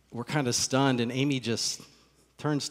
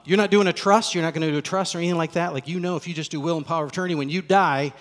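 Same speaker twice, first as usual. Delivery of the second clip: rapid, louder attorney patter; rattled off fast; 5 semitones higher, 7 dB louder, faster than usual. Retold or imitated. Attorney rattling off estate terms to the couple, fast. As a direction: reel off the legal terms at speed. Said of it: "he named all these things really, really fast".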